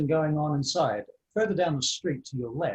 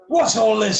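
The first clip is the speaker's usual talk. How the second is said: loud, high-pitched demand; raised voice barging into a commotion; blustering tavern man; 8 semitones higher, 10 dB louder, slower than usual. Acted. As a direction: burst out as an indignant man.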